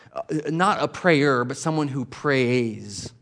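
slow, each word weighed, not a prayer versus someone who prays; slow emphatic contrast. Shown as a demonstration. Preacher explaining being prayerful, slow and emphatic. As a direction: lean on the contrast slowly. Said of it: "filled with prayer".

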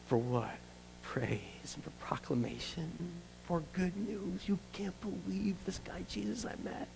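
soft, half-whispered awe; hushed awe. Acted. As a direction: lean in and hush it. Sermon turns hushed.

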